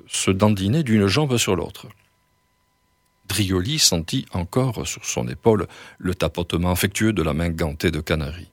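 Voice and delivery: plain reading voice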